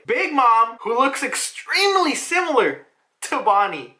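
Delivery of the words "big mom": ordinary talk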